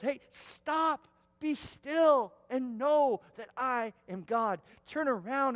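high, loud, insistent command to stop; commanding call. Acted. God commands panicking Israel at the Red Sea, loud and high. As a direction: call them to stillness with force.

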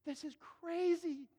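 high-pitched mock disbelief; scandalized onlooker. Listener at the back thrills at a coming confession, scandalized. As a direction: gawk aloud at a juicy scandal. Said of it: "I'm at the back going".

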